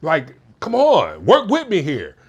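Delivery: high, worked-up nagging rant; fed-up spouse airing a grievance; frustrated complaint. Shown as a demonstration. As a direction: complain in exasperation, pitch climbing. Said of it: "the less effective way".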